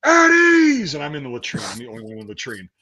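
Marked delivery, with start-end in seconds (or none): 0.1-0.9 much higher and louder barked command; drill sergeant shout. Retold or imitated. Drill sergeant entering, shouting the command. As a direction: bellow it across the barracks. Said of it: "you hear".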